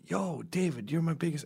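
high excited fan voice; a fan calling out to a celebrity; fan greeting. Retold or imitated. Fan hails David Cross on the street, high and eager. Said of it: "they would go".

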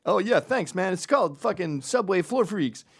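high, loud performer voice; flattered street dancer. Acted. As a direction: answer like a proud subway dancer. Dancer replying to fake tourist.

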